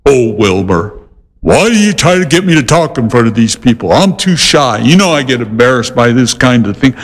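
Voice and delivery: a hint of a bashful horse, lightly voiced; shy talking horse. Horse complaining to his owner after the neighbors leave, lightly voiced and shy. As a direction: mumble like a shy horse embarrassed in front of guests. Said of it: "the minute the neighbor would leave".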